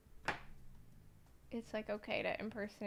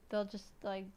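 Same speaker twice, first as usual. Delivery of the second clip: ordinary talk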